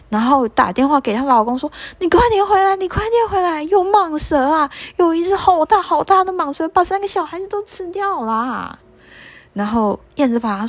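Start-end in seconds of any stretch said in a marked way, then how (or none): 2.0-8.8 high, frantic plea down the phone; desperate mother begging him to hurry home; panicked call. Acted. (燕子妈妈惊慌地打电话求救)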